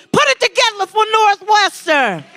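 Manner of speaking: rally speech. Real, not acted. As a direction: pump up the crowd, shouting. Host hypes sponsors to crowd.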